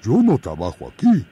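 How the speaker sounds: oso firme y sencillo; bear folk. Acted. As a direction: explica con calma que es oso. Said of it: "dijo el oso". (oso que protesta)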